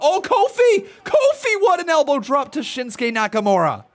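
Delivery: high, loud cry of delight; excited shout. Real, not acted. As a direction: yell the name in thrill. Big move seen live.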